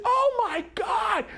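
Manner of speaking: high, drawn-out shocked scream; imitated scream. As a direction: mimic a sister screaming in shock. Sister cries out at the light.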